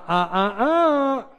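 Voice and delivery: strained rising groans, loud and high; hammed-up groan of a sore body; mock groaning. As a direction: groan like a sick body complaining. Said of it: "your body's going".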